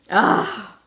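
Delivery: overflowing emotion through tears; laughing and crying at once; tearful love. Real, not acted. Tearful thanks to friend.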